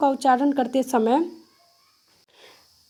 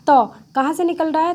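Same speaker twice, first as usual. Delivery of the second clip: normal talking voice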